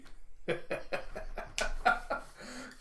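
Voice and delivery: soft breathy giggling; long, quiet chuckle; real laugh. Real, not acted. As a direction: laugh softly to yourself. Amused by Chopper's moment.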